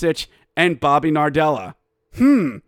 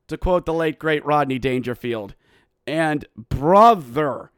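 ordinary delivery

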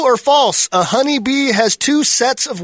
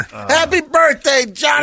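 shouting name; 5 semitones higher than usual. Real, not acted. Birthday cheer shout.

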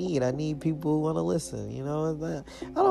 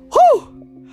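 loud whew; 17 dB louder than usual. Real, not acted. Relieved at finishing the episode.

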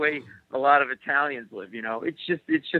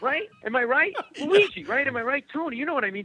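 Italian guy; 10 semitones higher than usual. Retold or imitated. Imitating Italian guys calling out.

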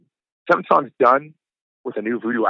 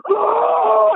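sudden high yelp, much louder; retold cry of pain; 5 dB louder than usual. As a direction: re-enact the startled shout as he bit. Retold being bitten at a show.

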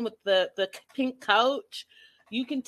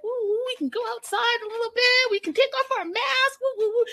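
much higher, whooping mock celebration; high excited whooping; mock cheering; 13 semitones higher than usual. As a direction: cheer like freed from lockdown. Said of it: "we start to, like".

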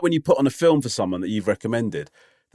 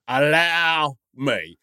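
very slow, stretched-out ALLOW ME; mock-gallant host, savour each word of "allow me"; hammed-up offer to take charge, dragged right out; drawn-out grand insistence; much slower than usual.

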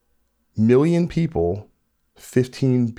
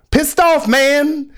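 much higher, much louder yell; angry shout; 6 dB louder, much slower than usual. Real, not acted. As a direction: blow up and yell it out. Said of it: "I'm a bit pissed off".

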